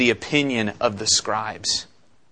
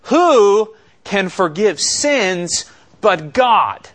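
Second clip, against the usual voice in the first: higher, louder and slow, each word pressed; indignant scribes' outcry; 7 semitones higher, 5 dB louder, much slower than usual. Acted. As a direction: voice the scribes' outraged challenge, slowly. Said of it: "Another question".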